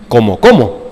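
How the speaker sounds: ¿Cómo, cómo? dropped low and flat; teacher's low echo. Retold or imitated. Preacher mimicking his old teacher, low answer. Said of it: "como decía mi maestro".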